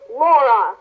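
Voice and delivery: a terrible warning in father's voice; stern father. A narrator's reading. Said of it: "Pa's voice was terrible".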